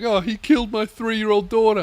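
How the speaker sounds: high mock wail; victim's relative. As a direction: imitate a grieving parent on TV. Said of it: "interviews with the victim's family".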